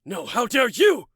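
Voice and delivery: high, loud, furious comeback; heated, high-pitched indignation; storming retort. Acted. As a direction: throw the outrage right back. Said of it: "He stormed".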